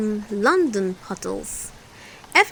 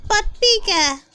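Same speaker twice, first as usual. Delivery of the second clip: put-on high, loud eager girl; Peppa; 10 semitones higher, 6 dB louder than usual. A narrator's reading. Peppa adding to the request, high and loud. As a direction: pipe up eagerly. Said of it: "adds Peppa".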